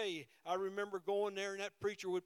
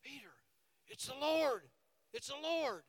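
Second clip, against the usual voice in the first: John telling Peter it is the Lord; excited recognition; 4 semitones higher than usual. Retold or imitated. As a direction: realize who it is and tell him. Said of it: "John looked at Peter and said".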